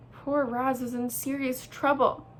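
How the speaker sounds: usual speaking voice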